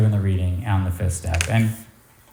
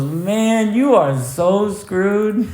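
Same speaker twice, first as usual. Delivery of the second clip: higher, slow, laughing through it; 11 semitones higher, much slower than usual. Retold or imitated. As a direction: crack up while delivering the verdict. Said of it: "He just starts laughing so hard".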